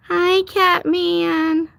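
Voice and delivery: drawn-out hi. Real, not acted. Drawn out greeting.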